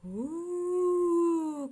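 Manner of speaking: high, eerie, long ooh; spooky cave wail. A narrator's reading. Brother reads the crying cave to scare Sister, hollow wail. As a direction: moan it like a haunted cave. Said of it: "cried the deep, dark, mysterious cave".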